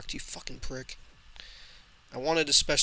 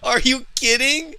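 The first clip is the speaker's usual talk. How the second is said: much higher and louder, slow, incredulous; outraged disbelief; 9 semitones higher, 12 dB louder, slower than usual. Real, not acted. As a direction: bellow it like you cannot believe the score. Outraged at the lopsided loss.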